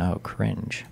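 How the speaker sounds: ordinary talk, nothing marked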